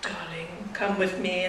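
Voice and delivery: low, soft seducer; quiet suave spy act; James Bond. Acted. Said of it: "Or you'd be like".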